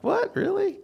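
high, startled mock disbelief; shocked disbelief. Pastor retelling his reaction to staff, mock shocked. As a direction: pitch jumps way up in retold shock. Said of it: "I'm like".

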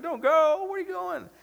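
very high, hammed-up panic; freaking out. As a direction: plead like mock-frantic apostles being left behind. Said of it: "The apostles are freaking out".

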